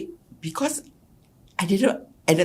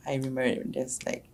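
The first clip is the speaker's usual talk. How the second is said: ordinary delivery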